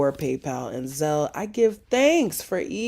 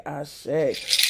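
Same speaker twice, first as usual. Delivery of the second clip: normal talking voice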